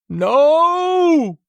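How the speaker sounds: very high, loud shriek; piercing scream. A narrator's reading. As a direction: scream loud enough to break glass. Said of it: "I screamed loud enough to break glass".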